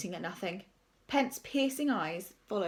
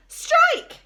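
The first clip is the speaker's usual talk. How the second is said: high, loud excited call; yell; 13 dB louder than usual. A narrator's reading. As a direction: yell it like a kid umpire. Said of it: "yelled a third".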